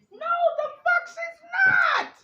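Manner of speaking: very high, loud, slow yelled denial; furious shout. Real, not acted. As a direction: scream the denial out. Rejecting the claim she is family.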